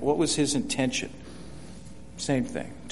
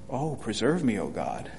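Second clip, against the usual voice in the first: softer, lower, pleading prayer; prayerful plea; 5 semitones lower, 6 dB quieter than usual. Shown as a demonstration. Teacher demonstrating the psalm's mood, softly pleading. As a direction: pray it like someone in trouble. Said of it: "kind of what they call the mood".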